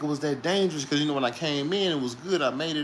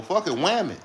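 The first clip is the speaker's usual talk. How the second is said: ordinary delivery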